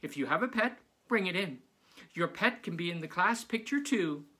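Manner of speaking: high, loud eager teacher; bubbly teacher announcing surprises. Acted. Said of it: "said Missus Zappi".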